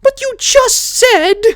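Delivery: very high, slow sputtering; flustered protest. A narrator's reading. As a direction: sputter in disbelief. Twilight protests.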